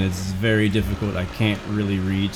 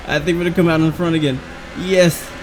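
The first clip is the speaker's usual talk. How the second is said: fast, high and louder with joy; excited; 7 semitones higher, 3 dB louder than usual. Real, not acted. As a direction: celebrate taking the lead. Gains the lead.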